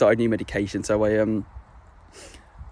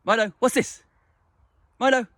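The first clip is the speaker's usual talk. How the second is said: much higher teasing question to a dog; high dog-directed voice; 13 semitones higher than usual. Real, not acted. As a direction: tempt the dog to play. Coaxing dog on camera.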